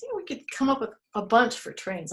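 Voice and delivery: ordinary talk, nothing marked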